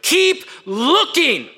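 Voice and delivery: high, slow drawn-out call; mock yelling. The narrative calling to students, slow and high. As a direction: stretch it high and slow like a nagging voice. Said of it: "is yelling at you".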